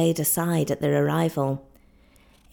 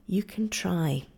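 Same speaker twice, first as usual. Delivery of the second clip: whisper; 4 dB quieter, slower than usual. Acted. Kiawe urging the boatswain.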